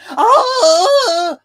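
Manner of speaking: high, loud squealing shriek; excited fan scream. Retold or imitated. Hyped fan losing it over a movie, loud scream. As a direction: let out a fangirl scream. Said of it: "I wasn't like".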